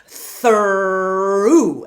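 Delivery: "Through" with a loud animal growl on the R; monster growl R. Shown as a demonstration. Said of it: "very monster, bear, tiger, panther sound".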